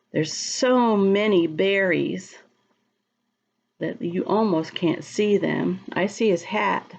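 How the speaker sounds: ordinary delivery, nothing marked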